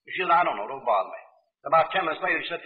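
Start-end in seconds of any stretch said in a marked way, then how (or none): 0.3-1.2 low, falling, offhand reply; dismissive mother, low-pitched brush-off. Retold or imitated. Mother waves away her boy's question on a train, mildly dismissive.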